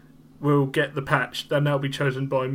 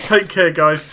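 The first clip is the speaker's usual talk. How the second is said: loud; 2 semitones higher, 10 dB louder, slower than usual. Real, not acted. Repeats cheery sign-off.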